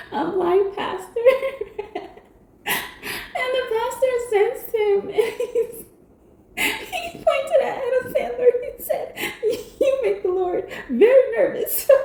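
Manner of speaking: high-pitched giggles through every word; real laughter bubbling over the story; laughing hard. Real, not acted. Telling Pile Five about Little Nicky, laughing helplessly. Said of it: "I'm not laughing at you".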